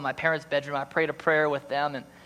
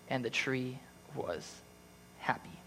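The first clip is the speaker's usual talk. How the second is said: story narration; 4 semitones higher than usual. A narrator's reading. Pastor reading The Giving Tree to church, tender storytelling. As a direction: read it like a bedtime story to the congregation.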